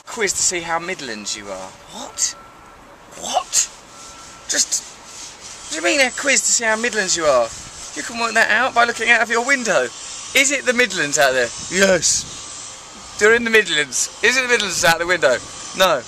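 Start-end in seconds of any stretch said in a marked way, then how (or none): 1.9-7.5 incredulo; baffled disbelief. Acted. Baffled by quiz headline. 7.9-16.0 sarcastic; mocking rant.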